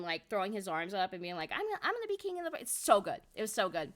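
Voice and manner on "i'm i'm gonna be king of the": higher, fast hyper Luffy imitation. Retelling how Luffy hyped at the fans, fast and high. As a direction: rattle it off like an overexcited pirate. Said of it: "he was hyper and jumping around".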